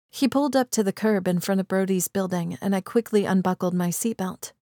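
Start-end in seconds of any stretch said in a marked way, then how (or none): none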